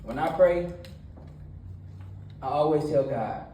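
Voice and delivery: energetic church talk; preaching. Acted. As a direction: preach it to the young crowd with energy. Youth sermon with retold story.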